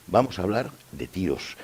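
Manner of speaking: normal talking voice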